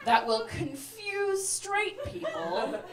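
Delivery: high, very slow pondering; hammed-up thinking aloud; mock scheming. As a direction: draw it out like hatching a plot. Acts out scheming to herself.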